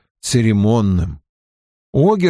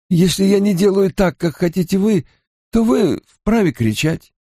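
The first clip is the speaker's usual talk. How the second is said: higher-pitched, earnest servant voice; Hurin, ceremonious retainer; 9 semitones higher than usual. Acted. Hurin explains proper conduct to his lord, ceremonious. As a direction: insist on propriety, pitched up.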